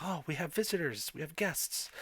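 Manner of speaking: high-pitched delighted villager; smiling villagers. Acted. Villagers see visitors arrive, high smiling welcome. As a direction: greet the guests with a big audible smile. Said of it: "got this big smile on their face".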